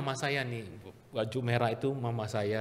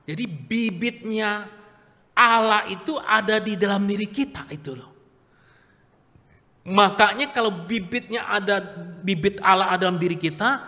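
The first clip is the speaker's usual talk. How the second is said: higher, louder preaching climb; rising preaching; 6 semitones higher, 5 dB louder than usual. Acted. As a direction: build it up to full voice. Preaching builds to peak.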